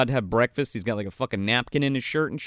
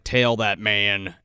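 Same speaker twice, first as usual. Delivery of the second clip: slow, lower-pitched imitation of an order; cop giving orders; 6 semitones lower, slower than usual. Imitating a cop ordering a tail, slow and low. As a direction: lay down the order slowly and low. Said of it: "and the guy's like".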